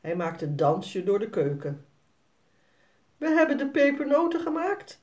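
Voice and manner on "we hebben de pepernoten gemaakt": put-on higher, fast proud boy; trots kind. A narrator's reading. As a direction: show off eagerly to Piet. Jasper trots tegen Piet. (hoger en snel trots jongetje)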